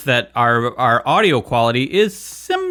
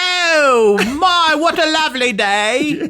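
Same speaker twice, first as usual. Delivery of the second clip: mock posh British; 13 semitones higher, much slower than usual. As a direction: sing out a posh British exclamation. Mocking British servers.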